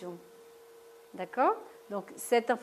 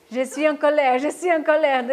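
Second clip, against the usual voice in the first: louder, emphatic repetition of je suis en colère; loud demo of anger; 3 semitones higher, 10 dB louder than usual. Shown as a demonstration. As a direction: say it out loud, as if angry in the staff room. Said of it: "Maintenant, je vais vous montrer".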